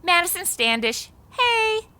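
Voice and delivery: usual speaking voice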